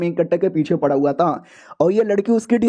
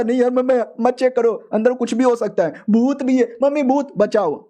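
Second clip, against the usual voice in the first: higher-pitched, lightly acted fright; mock panic; 5 semitones higher than usual. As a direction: plead as if half-scared of ghosts. Scared disciple begs not to check pit.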